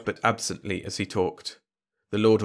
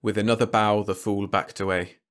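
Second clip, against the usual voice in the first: ordinary delivery, nothing marked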